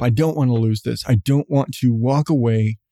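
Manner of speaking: normal talking voice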